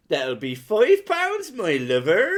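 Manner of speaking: high, loud, drawn-out Cornish shopkeeper; Cornish accent. Retold or imitated. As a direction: imitate a broad Cornish chip-shop woman. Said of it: "the Cornish".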